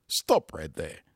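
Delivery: normal talking voice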